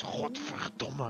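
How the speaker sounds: slow, drawn-out Dutch curse Godverdomme. Shown as a demonstration. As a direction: growl out Godverdomme slowly like a Dutchman. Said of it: "The Dutch accent is".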